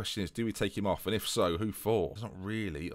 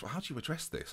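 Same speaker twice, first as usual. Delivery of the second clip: usual speaking voice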